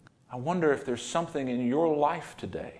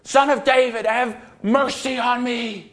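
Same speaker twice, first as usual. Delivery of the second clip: crying out much higher and louder, begging; 11 semitones higher, 8 dB louder than usual. Retold or imitated. Blind man cries all the more to Jesus, a desperate shout. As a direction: call even harder than before, desperate. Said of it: "he called all the more".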